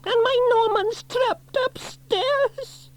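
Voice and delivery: very high, slow, pleading wail; frantic mother; panicked cry. A narrator's reading. Mother telling firefighters son is trapped, high panicked cry. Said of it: "cried Dillis in a panic".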